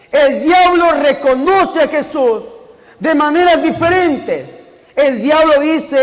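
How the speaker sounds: fervent pulpit preaching; preaching at full force. Acted. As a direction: preach it to the congregation with fervor. Preacher raises his sermon.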